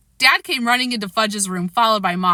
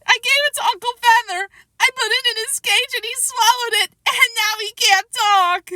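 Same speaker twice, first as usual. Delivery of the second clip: sobbing confession; 4 dB louder than usual. A narrator's reading.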